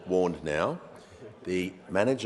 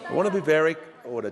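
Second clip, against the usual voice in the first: shouting; 9 semitones higher, 6 dB louder, faster than usual. Real, not acted. Speaker shouting over noise.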